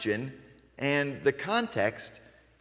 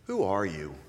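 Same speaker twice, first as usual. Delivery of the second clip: inviting question; 7 semitones lower, 3 dB quieter than usual. Shown as a demonstration. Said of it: "to something like this".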